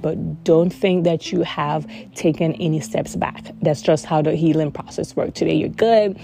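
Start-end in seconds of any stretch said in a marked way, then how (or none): none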